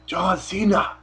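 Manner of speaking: put-on a slipped Schwarzenegger accent calling out a name; Arnold Schwarzenegger accent. Podcaster's failed Del Rio bit, slipping into a loose Schwarzenegger accent. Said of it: "my Mexican accent turned into Arnold Schwarzenegger".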